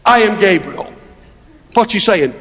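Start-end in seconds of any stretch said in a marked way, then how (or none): 0.0-0.9 "I am Gabriel" as a firm, weighted declaration; measured emphasis on "I am Gabriel"; emphatic. Acted. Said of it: "it's just as emphatic".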